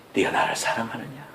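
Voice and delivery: soft, low, gentle asking; tender question. Acted. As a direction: ask him tenderly like a loving master. Third question to Peter.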